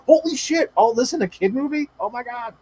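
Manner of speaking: high-pitched, incredulous reaction; shocked disbelief. Retold or imitated. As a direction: react aghast, pitch jumping up. Acting out shock at kid movie.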